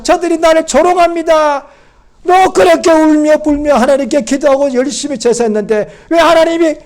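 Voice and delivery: high, loud acted outcry, building to the end; impassioned protest. Psalmist protesting delayed answers and mockery, impassioned outcry. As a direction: cry out the grievance at full force.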